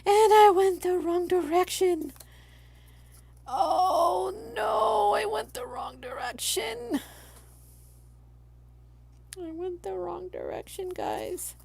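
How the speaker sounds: high, then fading to a high whispered wail; oh-no panic over a wrong cut; dismayed realization. Real, not acted. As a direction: gasp it out, horrified at the mistake.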